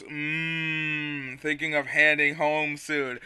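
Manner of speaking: slow, soft, sleepy drawl; the slow Quagsire voice kept up. Acted. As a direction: let the words crawl out with a hum. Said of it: "my Quagsire voice".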